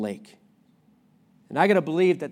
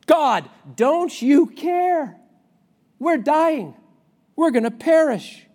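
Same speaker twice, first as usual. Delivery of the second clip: high, loud, slow pleading shout; desperate cries; 10 semitones higher, 7 dB louder, slower than usual. Retold or imitated. Disciples shouting to God amid the waves, desperate loud plea. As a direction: scream it over the storm. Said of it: "their desperate cries".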